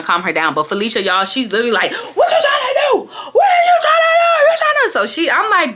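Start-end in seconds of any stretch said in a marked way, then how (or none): 1.9-4.9 shrieking high mock shout; going off. Friend still challenging her from front seat, going off. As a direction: mimic her relentless challenge, screeched. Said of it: "she really go off like".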